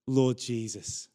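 usual speaking voice

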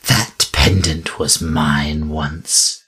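slow, a touch of grief; first trace of emotion. A narrator's reading. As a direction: let a little sorrow slip through, slowly. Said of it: "her voice betraying the first traces of emotion".

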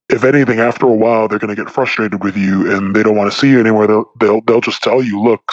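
usual speaking voice

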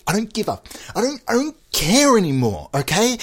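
high-pitched, exasperated rant; really mad. Pretend. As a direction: rant defiantly, pitch climbing as you insist. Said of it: "me getting really, really mad".

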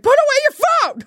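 high, loud scolding yell; retold scream. Telling off a woman with her phone out, retold scream. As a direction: re-enact yelling at someone across the room. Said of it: "yeah, I screamed. I was like".

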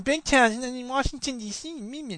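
high, mumbled mocking nerd voice; whining nerds. Teacher mocking nerds who nitpick Big Town, high nerd voice. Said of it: "And the nerds start going".

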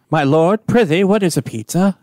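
put-on high, grand old-fashioned courtly voice; hammy stage actor. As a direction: ham it up like a medieval lord. Archaic knight answers modern question.

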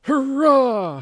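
high, loud crowd hurrah; wild mob cheer; howled cheer. A narrator's reading. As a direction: let out a howling cheer. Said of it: "howled the crowd".

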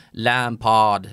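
Lampard långsamt och utdraget på brittiska; säg Lampard med överdriven brittisk brytning; överdriven brittisk dialekt. Shown as a demonstration. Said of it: "överdriver heller inte, liksom, brittisk dialekt". (tillgjort brittiskt Lampard)

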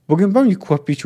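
loud, high curt snap; sharp retort. A narrator's reading. Ryans silencing Harsheim, sharp snap. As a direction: cut the question off hard. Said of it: "uciął gwałtownie Ryans".

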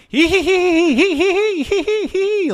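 very high-pitched imitated tee-hee giggle; mock giggling. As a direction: giggle manically like the guy fleeing. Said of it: "giggling and shit".

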